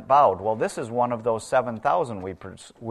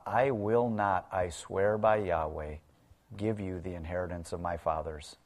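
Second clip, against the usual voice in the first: softer, hushed and grave, like a sworn vow; solemn quiet oath; 4 semitones lower, 7 dB quieter than usual. Retold or imitated. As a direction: swear it under your breath, deadly serious. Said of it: "He swears an oath saying".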